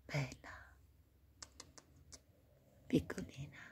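breathy hushed whisper to a kitten; tender hushed whisper. Real, not acted. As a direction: barely whisper it. Whispering to the cat.

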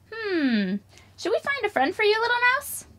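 ordinary talk

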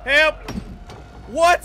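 very loud, high cries; alarmed yell. Real, not acted. Player caught by a game enemy, yelling for help.

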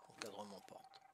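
whispered to himself. Real, not acted. Player muttering the instruction back to himself, whispered. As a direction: breathe the words under his breath.

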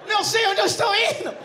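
high loud comic cry of being swept along; mock desperate shout. Carried off by the carnival crowd, loud comic shout.

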